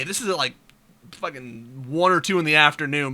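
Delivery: ordinary talk, nothing marked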